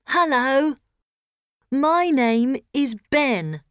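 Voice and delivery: put-on high child voice; lightly voiced young boy. Child character introduces himself.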